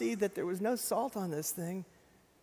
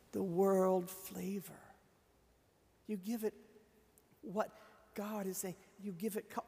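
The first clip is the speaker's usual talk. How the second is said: ordinary delivery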